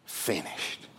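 It is finished trailing into a whisper. Acted. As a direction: let finished die on the breath. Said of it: "Jesus cried out".

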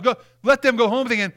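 normal talking voice

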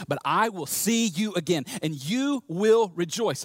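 slow, weighted "I will see you again"; emphatic promise. Acted. Jesus promising the disciples joy, slow and emphatic. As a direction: spread out "you will rejoice", word by word.

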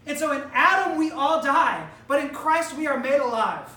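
full-voiced pulpit declaration; preaching. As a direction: preach it out to the congregation. Preacher builds to climax.

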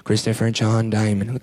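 imitated mother, low and slow; mom's full-name warning. Mom uses the whole name, imitated slow low warning. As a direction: spell out trouble with each name, mimicking mom. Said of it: "when it's to the point".

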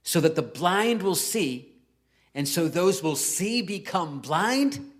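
slow, deliberate reading with a lifted tune; slow emphatic scripture reading; added inflection. Shown as a demonstration. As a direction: spell out each phrase slowly with dramatic inflection. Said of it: "I added the inflection".

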